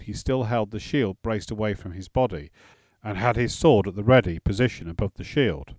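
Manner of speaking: plain reading voice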